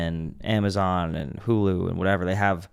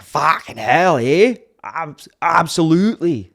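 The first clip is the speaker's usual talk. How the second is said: loud, high mock Yukon accent; rough Yukon fishing guy; hammed-up Yukon accent; 6 semitones higher, 5 dB louder, slower than usual. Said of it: "out in the Yukon, we're like".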